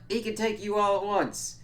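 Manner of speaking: high-pitched mock bragging; imitated boast. Vampire boasting about his familiar, mock bragging.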